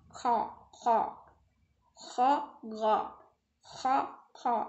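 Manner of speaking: "Kha" with a rough scrape at the back of the throat; teacher drilling Kha; letter demo. Shown as a demonstration. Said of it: "Tapi kita harus bisa membedakannya".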